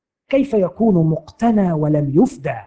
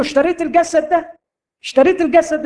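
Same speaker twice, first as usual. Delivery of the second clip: high taunting challenge from the disease; 9 semitones higher, faster than usual. Acted. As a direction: jeer at the Lord in a raised voice. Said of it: "يعير سيدي". (المرض يعاير بصوت عالي ساخر)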